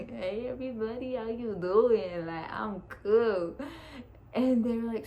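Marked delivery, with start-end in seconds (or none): 0.1-3.6 slow, stretched-out druggy greeting; loopy, spaced-out imitation; doped up. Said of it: "I was doped up".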